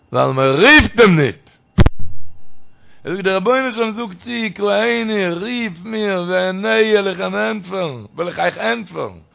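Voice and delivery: drawn-out declamation with falling phrase ends; preaching. Acted. Preacher quoting God's promise to answer, drawn-out declamation. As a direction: preach it in long, emphatic phrases.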